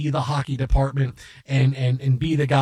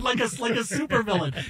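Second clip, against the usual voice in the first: ordinary talk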